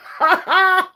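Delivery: loud, high burst of laughter; laughing. Real, not acted. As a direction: burst out laughing at your own story. Amused by own taxi story.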